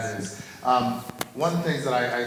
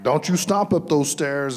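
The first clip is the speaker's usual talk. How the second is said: raised, slowed-down telling-off from a dad; stern warning; 6 semitones higher, 5 dB louder than usual. Retold or imitated. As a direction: scold the stomping kid, higher and louder. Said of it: "and I'm like".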